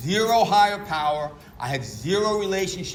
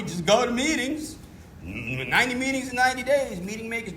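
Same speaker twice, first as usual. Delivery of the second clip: higher and faster, defensive and whiny; indignant protest; 6 semitones higher, faster than usual. Retold or imitated. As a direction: protest like you're the victim who's already doing enough. Acting out his defensive younger self.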